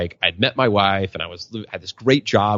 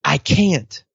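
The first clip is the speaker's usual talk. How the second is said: put-on higher, small voice of a stuck four-year-old; little boy voice; 7 semitones higher, much slower than usual. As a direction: play the scared little son, lightly. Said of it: "he goes".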